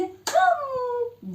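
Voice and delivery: high playful hum of swallowing; gulping sound. Pretend. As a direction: gobble the fish with a squeaky hum. Ördekler balığı yutuyor. (tiz, oyunbaz yutma sesi)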